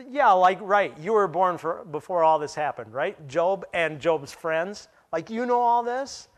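incredulous mock agreement, loud at the start; sarcastic. God mocking Job and Job's friends, sarcastic. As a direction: scoff at the claim, rising into disbelief at the end. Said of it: "Hear the sarcasm in God's voice".

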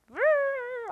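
high, short trilled "rrr" of annoyance; imitated grumbling growl. Mad at her late teammates, imitated grumble. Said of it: "all the time grumbling and complaining".

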